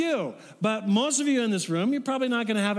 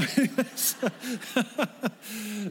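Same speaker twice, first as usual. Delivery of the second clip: amused laugh; 4 semitones higher than usual. Real, not acted. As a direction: run of short chuckles. Laughs at own mispronunciation story.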